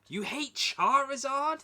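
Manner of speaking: disbelieving high-pitched question; shocked. Real, not acted. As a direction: ask it in total disbelief. Shocked at a disliked favourite.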